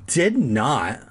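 plain reading voice

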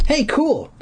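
ordinary delivery, nothing marked

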